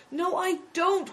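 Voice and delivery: high, slow, tearful protest; nearly crying. Acted. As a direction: protest on the edge of tears. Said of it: "who was nearly crying".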